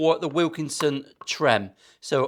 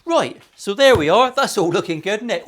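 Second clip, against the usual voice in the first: bright retake intro; 5 semitones higher, 6 dB louder than usual. Real, not acted. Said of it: "I'm gonna walk on and do that again".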